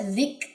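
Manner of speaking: soft ذک with air on the k; breathy kaf. Shown as a demonstration. Teacher demonstrating hams on ذک. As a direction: let breath escape on the k of ذک. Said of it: "اس میں ک کی sound آئے گی".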